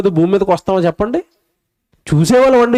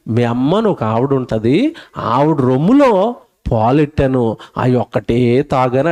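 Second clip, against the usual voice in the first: God's gentle advice; 7 semitones lower than usual. Retold or imitated. God advising the baby.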